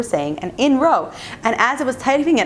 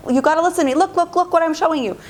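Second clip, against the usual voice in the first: higher, pestering plea for attention; insistent daughter, imitated; 8 semitones higher, much faster than usual. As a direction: nag mom to look, pitched up. Daughter demands mother's attention.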